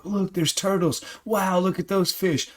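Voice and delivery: high-pitched, wide-eyed amazement; excited calling out. Retold or imitated. Retold calling out underwater sights to wife.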